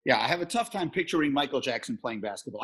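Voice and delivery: ordinary delivery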